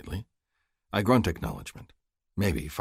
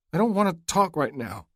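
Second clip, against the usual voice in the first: near tears; 12 semitones higher than usual. Acted. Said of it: "a husky note in her throat".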